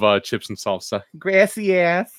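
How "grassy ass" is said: high mock gringa "Grassy ass"; meme gringa voice. Quoting the meme lady thanking for chips, high mock accent.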